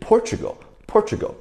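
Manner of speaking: Portugal with "chu" in the middle. Shown as a demonstration. Teacher repeats for the class, Portugal with ch for T. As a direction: say Portugal as Por-chu-gal. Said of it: "去的音".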